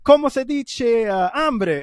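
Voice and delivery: high, slow Spanish in a hammed-up Italian accent; loud, halting mock-Italian accent, groping for words; fake Italian accent. Mocking their own early attempts at Italian, exaggerated Italian accent. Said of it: "Spanish words with an Italian, uh, accent".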